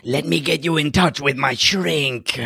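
joining in with a put-on empress voice; hammed-up alien queen; imitated Araxa. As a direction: offer advice in mock-regal tones. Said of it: "She should be saying".

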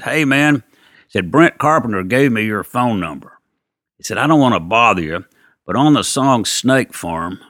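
a hint of an eager guitarist asking about gear. [name]'s phone question retold.

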